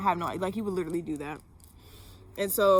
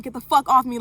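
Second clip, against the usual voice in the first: raised, louder and higher mock yell; retold scream; 4 semitones higher, 8 dB louder than usual. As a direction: act out screaming at him, without a full scream. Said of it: "I started screaming".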